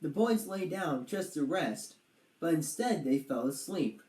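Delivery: usual speaking voice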